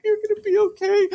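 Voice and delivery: high-pitched, tender girl imitation; soothing coo. Crush rubbing his hair on the couch, soothing coo. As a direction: comfort a crying kid in a put-on high voice.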